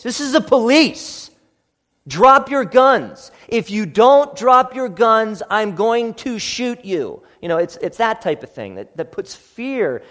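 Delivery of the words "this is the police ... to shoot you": loud, slow and much higher, barking each word; stern police command. Retold or imitated. A police officer confronting armed people, loud slow commands. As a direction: get right up in their face and bark it. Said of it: "You gotta get up in their face".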